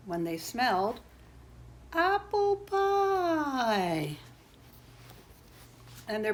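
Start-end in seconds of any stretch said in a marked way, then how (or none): 1.9-4.2 very slow, high drawn-out delight; rapturous craving. A narrator's reading. Two boys smell pie outside, long high rapturous call. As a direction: stretch the words out high, gliding down at the end.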